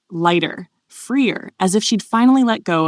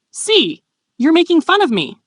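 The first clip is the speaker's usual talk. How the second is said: high, louder indignant outburst; exclaiming; 11 semitones higher, 6 dB louder than usual. A narrator's reading. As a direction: cry out in protest at being teased. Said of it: "Lucia exclaimed".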